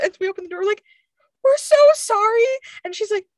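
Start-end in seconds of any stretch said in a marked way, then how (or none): 1.4-2.4 high, loud, gushing mock apology; retold apology. Jumpy friends to girl studying, retold apology. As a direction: imitate embarrassed friends apologizing profusely.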